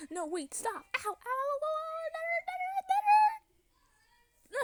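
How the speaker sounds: play-acted yelps climbing to a high squeal; pretend pained cries. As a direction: squeal as if being hit. Mermaid being beaten by Chipper.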